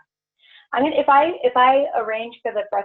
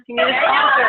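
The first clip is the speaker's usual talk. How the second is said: laughing through the line; tossed off with a laugh; giggling as she says it; 6 semitones higher, 4 dB louder than usual. Real, not acted. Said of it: "repeated line".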